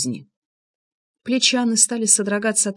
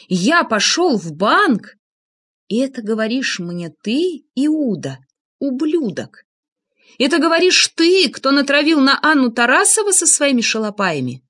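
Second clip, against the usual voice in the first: indignant outrage; 6 semitones higher than usual. A narrator's reading. Narrator turning the blame on Влад, indignant outrage. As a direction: throw the accusation back, outraged. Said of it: "опешила от такой наглости".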